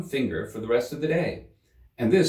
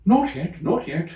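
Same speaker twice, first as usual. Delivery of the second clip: much higher, louder hurried interruption; hasty rabbit; 9 semitones higher, 5 dB louder than usual. A narrator's reading. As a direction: cut in fast and flustered. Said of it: "the rabbit hastily interrupted".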